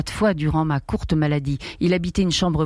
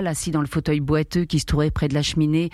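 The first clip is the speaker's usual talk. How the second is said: usual speaking voice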